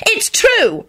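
high-pitched indignant squeal; high, outraged protest; furious squeal. A narrator's reading. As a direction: insist in a girlish squeal. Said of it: "Rosie squealed furiously".

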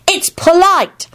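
put-on slow, high childish pronouncement; righteous child. A narrator's reading. Teddy lectures Neville on manners, righteous. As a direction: draw it out high, sure of being right. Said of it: "he told himself righteously".

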